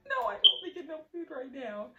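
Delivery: acted-out frazzled outburst; freaking out. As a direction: snap back, high and fed up. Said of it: "freaking out in my head".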